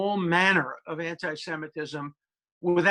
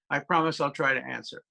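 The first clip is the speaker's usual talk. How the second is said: usual speaking voice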